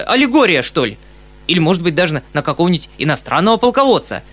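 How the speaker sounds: argue, then warm to a clever discovery; animated, fussing commentary; excitable uncle. A narrator's reading.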